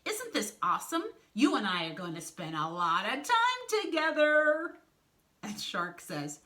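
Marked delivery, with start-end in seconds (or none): 0.0-4.7 high, gushing cartoon enthusiasm; bubbly, high and loud; gushing excitement. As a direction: gush loudly at a new partner. Wolf gushes to scared shark.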